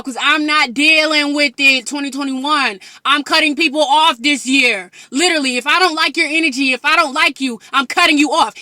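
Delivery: loud, fired-up telling-off; emphatic rant. Real, not acted. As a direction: lay down the law at full voice. Declares she is cutting people off.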